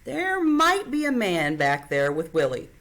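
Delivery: plain reading voice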